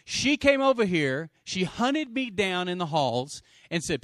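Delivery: forceful preaching. Real, not acted. Preacher urges church to find and tell, forceful preaching. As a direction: proclaim the verse to the room.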